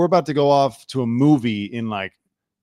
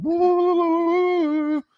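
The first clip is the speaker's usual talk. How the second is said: very high wailing woo; imitated theme music; slower than usual. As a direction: mimic the theme with a high hoot.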